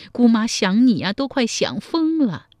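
high, loud cry of longing; aunt crying out to her long-lost nephew; emotional outburst. A narrator's reading. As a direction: burst out with tearful longing. Aunt reunited after many years.

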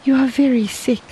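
mild alarmed cry. A narrator's reading. Wife fears her husband is ill, mild alarmed cry. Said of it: "cried Xie".

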